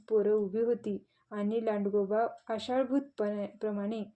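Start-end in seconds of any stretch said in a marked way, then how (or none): none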